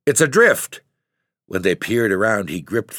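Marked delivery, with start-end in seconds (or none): 0.0-0.8 frantic orders, much higher and louder; angry shouting. Acted. Father ordering boys to search, angry shouting. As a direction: yell it in panic and rage.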